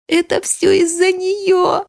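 put-on very high, drawn-out child's wail; last desperate yell. A narrator's reading. Boy takes a breath and yells his blame. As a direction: stretch it into a high final cry. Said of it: "заорал".

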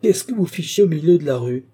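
loud, high-pitched reprimand; stern. A narrator's reading. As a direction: scold the coachman sharply. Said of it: "se tournant avec sévérité vers le cocher".

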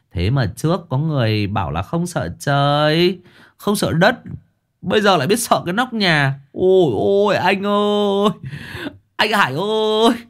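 high mocking singsong teasing; mocking cackle. Younger brother taunting Hải, mocking singsong. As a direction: taunt him gleefully. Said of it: "cười khành khạch".

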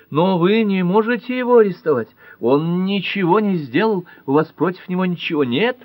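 high, loud frantic woman's voice; frantic Nora. Acted. Wife protests husband's arrest, high frantic pleading.

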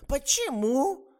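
очень высокий громкий вопрос; изумлённо переспрашивает; изумление. A narrator's reading. Изумлённая старуха. (изумлённый высокий старушечий вопрос)

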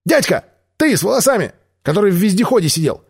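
lightly voiced girl calling out high; ringing child's voice. Инка звонко зовёт человека с волосами к Део. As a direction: call out like a bold little girl. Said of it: "звонкий голос Инки".

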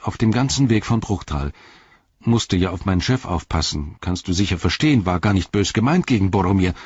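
talkative servant, building to a high excited blurt; eager, rambling self-justification; chattering Sam. Acted. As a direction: start apologetic, then get carried away.

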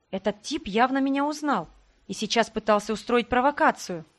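ordinary delivery

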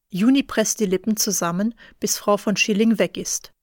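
usual speaking voice

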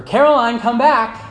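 high, loud parental call. Retold or imitated. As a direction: call after a runaway toddler. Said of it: "I call out to her".

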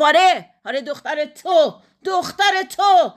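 high, loud, furious insistence; angry, breathless jeer. A narrator's reading. Husband pointing at the photo, angry and breathless. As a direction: hammer it home, stretching the repeat. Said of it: "با خندهٔ عصبانی".

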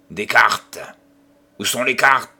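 loud, raging demand; furious demand. Acted. Enraged old gambler shouting for the cards. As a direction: bark for the cards in fury. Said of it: "sa colère se rua encore".

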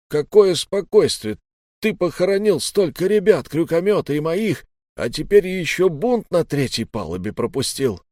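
heated rebuke that eases slightly at the end; outraged. A narrator's reading. Old man blaming the captain for deaths and mutiny, outraged.

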